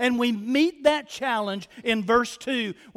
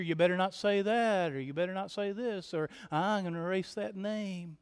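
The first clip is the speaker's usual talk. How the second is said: low-key, softened warning; muted mock threat; 3 semitones lower, 9 dB quieter than usual. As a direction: mimic a quiet threat from the pews. Voices congregants threatening the preacher.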